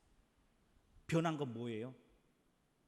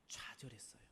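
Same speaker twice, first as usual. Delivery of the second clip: breathy hushed whisper; whispered despair; 4 semitones lower, 14 dB quieter than usual. Acted. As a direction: drop to a whisper of defeat. Preacher dramatizing despair.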